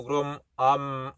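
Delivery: usual speaking voice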